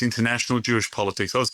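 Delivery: ordinary talk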